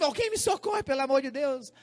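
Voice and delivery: high mock plea for rescue; imitated crying child. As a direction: play a tearful little girl begging for help. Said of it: "E ela chorando ali".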